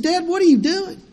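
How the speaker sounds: a hint of a teenage daughter asking in disbelief; daughter's voice. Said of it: "She said".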